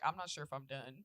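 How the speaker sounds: low, soft, unsure murmur; quiet hesitant worry; hushed and uncertain. Retold or imitated. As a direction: say it quietly, still stuck on the toilet. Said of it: "I was like".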